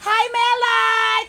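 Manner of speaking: "Hi, Melay!" called out loud, slow and high; excited greeting. Real, not acted. Greets a viewer during live selling.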